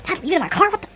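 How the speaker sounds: acted-out rising confusion, voice jumping up high; mock panic over a missing item; flustered panic.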